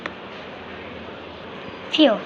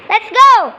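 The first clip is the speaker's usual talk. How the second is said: high and loud rallying call; excited cheer; 7 semitones higher, 7 dB louder than usual. Pretend. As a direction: fire everyone up to go. Kid cheering on toy birds.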